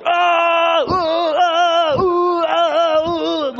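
long, high, loud alternating wails; hammed-up back-and-forth screaming; yelling at each other. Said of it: "yell at each other".

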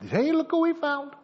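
high-pitched call of discovery; excited princess. Acted. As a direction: call out high, thrilled at a find. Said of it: "Then the princess comes down".

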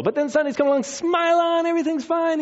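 high, put-on cheery voice, mocking church faces; a hammed-up Sunday smile in the voice, pitched well up; fake church smile. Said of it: "it's fake time".

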